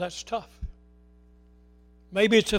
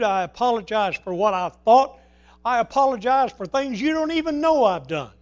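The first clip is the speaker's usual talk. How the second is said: loud, driving sermon voice, every line pushed; rising preacher's fervour, almost shouting; full-force preaching; 2 semitones higher, 8 dB louder than usual. Acted. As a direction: preach the apology at the whole room.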